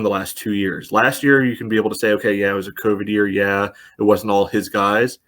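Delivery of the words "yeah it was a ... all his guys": ordinary delivery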